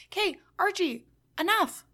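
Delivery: mock scolding, pitch up; exasperated. Real, not acted. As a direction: tell Archie off, done with him. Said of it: "exasperated aside to character".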